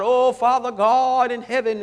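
loud, slow mock-solemn praying; deep drawn-out prayer. Preacher mocking showy prayers, deep drawn-out style. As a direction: draw out every word with mock piety. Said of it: "deep drawn out".

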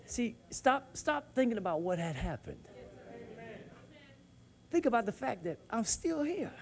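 usual speaking voice

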